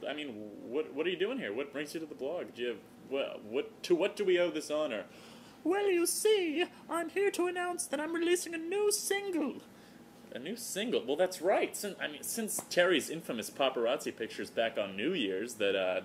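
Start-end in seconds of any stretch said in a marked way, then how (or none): 5.6-9.6 Terry the giraffe. Acted. Terry the giraffe greeting her blog fans in falsetto. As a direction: greet the fans like a tipsy celebrity in a high voice.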